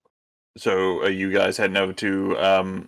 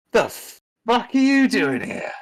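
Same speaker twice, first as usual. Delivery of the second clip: much higher, slow, stunned outburst; shocked demand; 14 semitones higher than usual. Acted. Trent seeing his long-gone mate walk in, stunned. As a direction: blurt it in disbelief. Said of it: "looks over at him and he's like".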